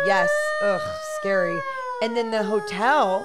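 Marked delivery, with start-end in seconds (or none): 0.1-1.6 high wailing tornado siren; siren impression. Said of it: "Props to the siren impression".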